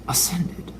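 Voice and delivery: mock awed whisper. Shown as a demonstration.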